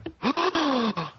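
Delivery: imitating an over-dramatic audience gasp; mock gasp. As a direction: gasp like a shocked onlooker. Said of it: "they go".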